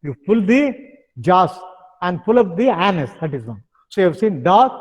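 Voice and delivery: usual speaking voice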